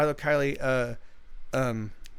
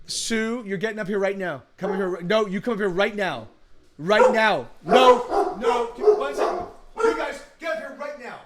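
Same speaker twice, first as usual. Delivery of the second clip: yelling across the room; 4 semitones higher, 5 dB louder than usual. Real, not acted. Calling dogs from across room.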